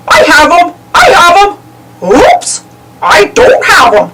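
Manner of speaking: excited yelps; Goofy losing the slippery frog; yelping. A narrator's reading. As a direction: yelp in a scramble. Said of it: "Goofy yelped".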